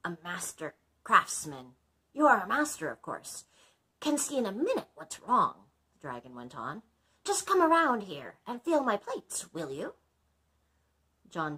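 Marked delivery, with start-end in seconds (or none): 0.1-5.7 higher, louder dragon voice. Acted. 7.2-10.0 higher, louder dragon voice. Acted.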